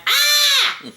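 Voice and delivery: sudden very high startled shriek; short, high yelp of shock. Retold or imitated. Woman realizes she is in a stranger's car, high shriek. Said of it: "I looked at him and I went".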